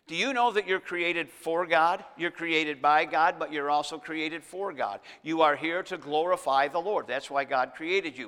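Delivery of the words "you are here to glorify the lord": ordinary delivery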